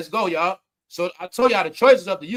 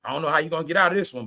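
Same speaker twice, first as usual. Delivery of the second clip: ordinary talk, nothing marked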